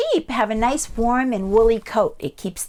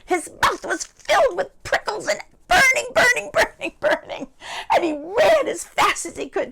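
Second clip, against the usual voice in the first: high, louder panicked telling; frantic narration; 11 semitones higher, 5 dB louder than usual. A narrator's reading. Narrator telling of the wolf's burning mouth, frantic and high. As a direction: cry out each repeat, high and urgent.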